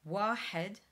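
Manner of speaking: Wahed with a pharyngeal H; throaty H. Shown as a demonstration. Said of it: "The H in wahed is the same sort of H".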